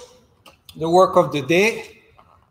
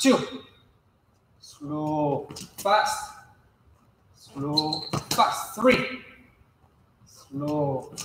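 calling the rhythm; 4 dB quieter, slower than usual. Shown as a demonstration. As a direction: coach calling the drill in time with the steps. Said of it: "coach calls footwork rhythm".

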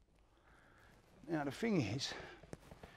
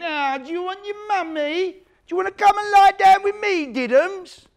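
mocking taunt; 14 dB louder than usual. Cons taunting the crying new kid, mocking baby voice. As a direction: sneer at the crying kid like a bully. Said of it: "you can hear him going".

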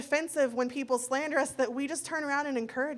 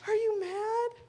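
put-on much higher, timid child asking; little girl's voice; 9 semitones higher, slower than usual. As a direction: squeak it out like a worried little girl. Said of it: "She's like".

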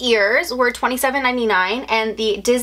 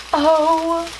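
gasping, high and delighted; excited gasp; 7 semitones higher, slower than usual. Real, not acted. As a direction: gasp at the rose gold find, voice jumping up. Unboxing a longed-for item.

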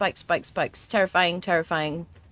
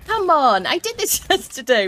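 frustrated shout; 7 semitones higher, 6 dB louder than usual. Real, not acted. Stuck, can't jump, frustrated.

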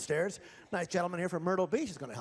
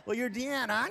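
ordinary delivery

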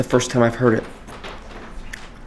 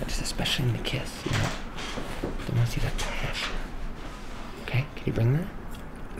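whispering; 7 dB quieter than usual. Real, not acted. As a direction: whisper it like a secret. Director coaching the love scene.

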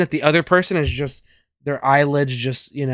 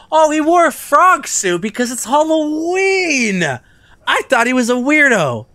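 higher, louder sudden realization; surprised; 12 semitones higher, 6 dB louder than usual. Real, not acted. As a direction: discover it all at once.

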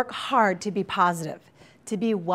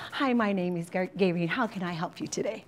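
softer, sweet receptionist greeting; a hint of an overly pleasant helper; lightly imitating sweet coworker; 6 dB quieter than usual. Counselor mimicking her sweet coworker at the desk, softer. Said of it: "This is what I hear".

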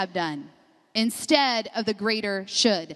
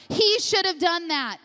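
ordinary delivery

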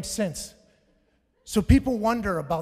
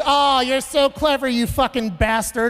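mom snapping back; 5 semitones higher, 5 dB louder than usual. Retold or imitated. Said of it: "And then she goes".

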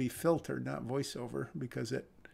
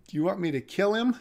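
"Do you want me to kill him?" rises high and loud, quick; shocked question; 5 semitones higher, 9 dB louder, faster than usual. Acted. Reader voicing Grace's stunned question, high and loud. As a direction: blurt it out in disbelief. Acting the character's shocked line.

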